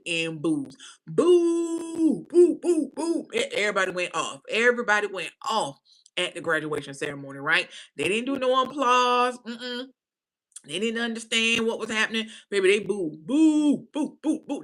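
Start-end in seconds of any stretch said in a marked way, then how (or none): 1.1-3.3 loud mock boos, high; loud booing imitation; crowd booing. 13.2-14.6 loud mock boos, high; loud booing imitation; crowd booing.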